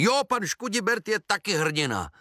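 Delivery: high-pitched group of little voices; high storybook chorus; everyone chiming in. A narrator's reading. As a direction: chime in like a whole crowd of kids. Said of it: "všichni řekli".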